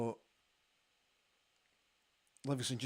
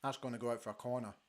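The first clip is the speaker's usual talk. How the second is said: talking through a mouthful of biscuit; mouth full; 4 semitones lower, 10 dB quieter, faster than usual. Real, not acted. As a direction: chew and talk at once. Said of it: "speak with your mouth full".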